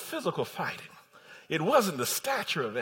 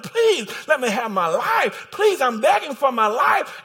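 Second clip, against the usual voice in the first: loud, high-pitched plea; Haman's desperate pleading, acted out; begging; 8 semitones higher, 10 dB louder, slower than usual. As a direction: beg for your life in a preacher's shout. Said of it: "he starts begging the queen".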